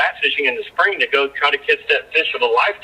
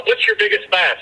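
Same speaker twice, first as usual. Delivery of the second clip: eager question. Retold or imitated. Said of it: "I would always ask my guys".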